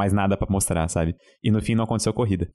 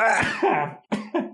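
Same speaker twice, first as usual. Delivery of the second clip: a real sneeze; sneeze, short and sudden; sneezing; 14 semitones higher than usual. Real, not acted. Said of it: "Pera aí que eu vou espirrar".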